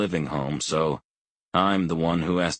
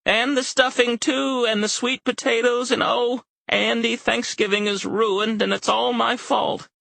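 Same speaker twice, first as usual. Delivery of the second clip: put-on much higher, louder panicked old woman; frantic old mother; 4 dB louder than usual. A narrator's reading. Elderly mother to her son, panicking over Thanksgiving. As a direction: wail it like a frail mother in a panic.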